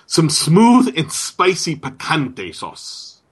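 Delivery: slow smooth commercial voice; ad read. Pretend. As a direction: sell it like a TV ad. Mock sponsor ad read.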